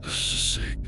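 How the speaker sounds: plain reading voice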